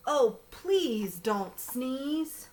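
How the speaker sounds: drawn-out plea, long vowels; slow pleading. Acted. Bear begging the elephant, slow drawn-out plea. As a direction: stretch out please don't sneeze, begging. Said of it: "slow pleading refrain".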